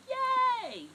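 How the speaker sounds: high, loud squeal of joy; excited yay. Shown as a demonstration. Office worker cheering a rising stock market, high and loud. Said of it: "Instead of going".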